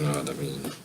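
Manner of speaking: low, soft murmured repeat; quiet echo. Real, not acted. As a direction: mumble it to yourself.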